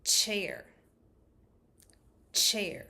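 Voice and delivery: "chair" said loud and crisp, falling; clear word. Shown as a demonstration. Therapist presenting the word chair. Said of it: "The next word is".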